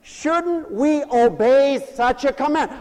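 ordinary talk

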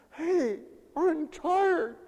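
mock-tired churchgoer; mock complaint. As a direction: drag out the weary excuse. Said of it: "Isn't that famous".

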